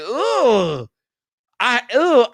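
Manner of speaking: drawn-out, high groan of disgust; disgusted ugh. Retold or imitated. Creator recalling his rewatched videos, disgusted groans.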